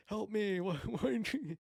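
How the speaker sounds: high, loud mock cry for help; mock yell. Jesus on the cross yelling to no one, mock loud cry. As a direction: yell up to the empty sky in jest. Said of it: "he's literally yelling to no one".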